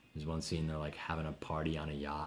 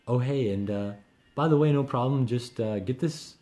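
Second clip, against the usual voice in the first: high, loud fake-breezy boss; passive-aggressive boss; 5 semitones higher, 6 dB louder than usual. Mimicking a boss dumping work at seven, sugary high. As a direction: sweeten a late-night demand like it's nothing. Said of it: "some boss who's like".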